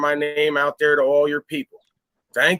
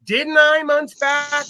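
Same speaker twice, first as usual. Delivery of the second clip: much higher and louder, told-you-so crowing; gloating shout; 10 semitones higher, 3 dB louder, much slower than usual. Real, not acted. Streamer reminding his chat he called it, shouted high. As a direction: rub it in, pitch jumping up high.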